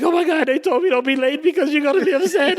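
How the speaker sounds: high, breathless imitation of a scared girl; mock panic. Assistant explaining herself to the photographer, high mock panic. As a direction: play the kid terrified of being late.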